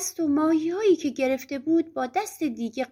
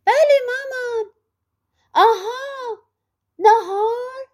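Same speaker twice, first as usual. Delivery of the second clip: much higher, louder screechy voice; screechy girl voice; 11 semitones higher, 6 dB louder, slower than usual. Acted. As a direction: squeal it like a spoiled little witch. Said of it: "با صدای جیغ جیغی".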